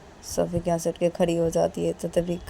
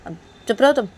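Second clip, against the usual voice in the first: raised, higher tattletale voice; bratty girl threatening; 4 semitones higher, 6 dB louder than usual. Acted. Girl threatening to tell her friend's mother, raised and taunting. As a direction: taunt that you'll tell mum. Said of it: "तो तभी रेशमा बोलती कि".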